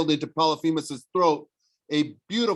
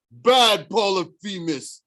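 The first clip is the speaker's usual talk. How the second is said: scolding Tyson; 5 semitones higher, 6 dB louder, slower than usual. A narrator's reading.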